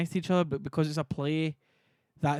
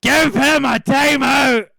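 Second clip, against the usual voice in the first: very loud, slow, raised-pitch bellow; full-force yell; 7 semitones higher, 15 dB louder, much slower than usual. Real, not acted. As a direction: bellow the order, stretching each word. Repeats timeout order, yelling.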